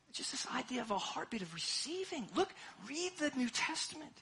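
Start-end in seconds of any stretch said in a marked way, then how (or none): none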